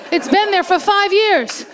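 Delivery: ordinary delivery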